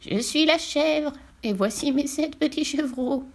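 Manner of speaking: high, slow bleating goat. A narrator's reading. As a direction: voice a gentle nanny goat. Goat character speaks.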